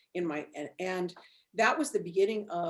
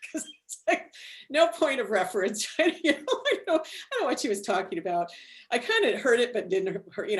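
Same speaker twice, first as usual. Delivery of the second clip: talking through laughter, higher and louder; laughing it off; 5 semitones higher, 7 dB louder than usual. Real, not acted. Guest tells the host she was baffled, laughing. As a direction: giggle through the words, baffled.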